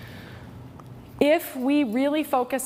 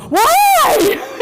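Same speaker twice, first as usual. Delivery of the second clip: startled shout; 12 dB louder than usual. Retold or imitated. Mom startled by son's outburst.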